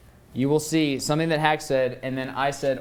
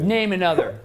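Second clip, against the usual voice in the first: loud, raised-pitch demand; forceful challenge; 5 semitones higher, 5 dB louder than usual. Real, not acted. Player daring rival to name witnesses, forceful challenge. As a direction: challenge them to prove it, loudly.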